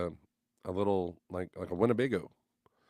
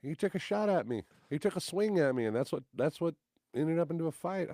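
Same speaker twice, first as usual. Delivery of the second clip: mock excuse; 8 semitones higher than usual. Retold man's excuse.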